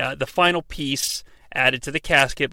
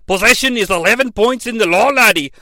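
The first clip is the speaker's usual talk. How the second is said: put-on higher, louder, clumsy Scottish accent; bad Scottish accent; 4 semitones higher, 4 dB louder than usual. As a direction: ham up a poor Scottish brogue. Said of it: "really shitty Scottish impression".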